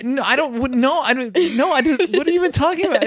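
very high, squeaky hammed-up protest; mock-frantic explaining, voice way up high; flustered self-defence. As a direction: babble excuses like an accused teenager. Retold frantic denial to dad.

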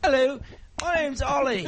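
high mock Essex lad; hammed-up pop hopeful impression. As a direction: introduce yourself in a piping put-on voice. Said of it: "The next one is [name]".

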